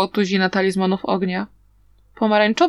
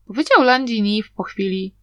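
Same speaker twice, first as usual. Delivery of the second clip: ordinary delivery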